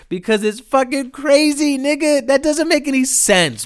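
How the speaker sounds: exasperated outburst. Real, not acted. Ranting to viewers about the crash, high exasperated outburst. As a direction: pitch jumps way up in disbelief.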